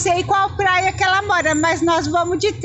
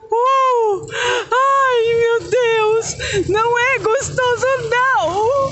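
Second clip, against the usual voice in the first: scared squeal; 4 semitones higher, much slower than usual. Real, not acted. Woman in a jolting cable car, scared yelp. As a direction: yelp as the cabin jolts.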